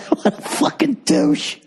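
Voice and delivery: high, slow sneering voice; scornful put-on voice. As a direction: drag it out in disgust. Mocking imagined bro viewer.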